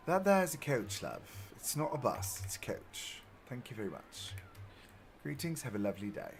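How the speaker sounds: mock-polite British sign-off; British accent. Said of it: "the British love their coaches".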